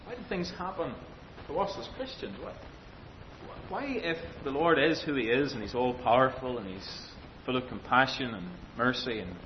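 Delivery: normal talking voice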